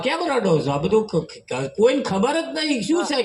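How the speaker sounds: a touch of the baffled headman, voice climbing; puzzled headman. Retold or imitated. Headman asks grandmother why she cries.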